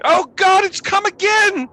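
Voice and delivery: high, loud shriek of terror; panicked screaming. Acted. Villagers heard from outside the mead hall, screaming in panic. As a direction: villager crying out in alarm. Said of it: "you hear human sounds screaming".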